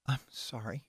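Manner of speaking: barely audible, breathless whisper. A narrator's reading. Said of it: "couldn't manage to get her voice above a breathless whisper".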